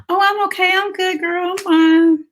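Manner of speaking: high, bright pretend-happy voice; fake cheerful. Mom hiding burnout from a friend, forced cheerful high reply. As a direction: chirp that you're fine when you're not. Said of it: "how many times you say".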